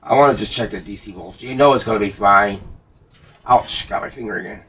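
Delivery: ordinary talk, nothing marked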